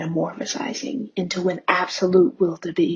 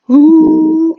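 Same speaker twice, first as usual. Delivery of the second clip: excited hoot; 9 semitones higher, 13 dB louder than usual. Pretend. Feral noise.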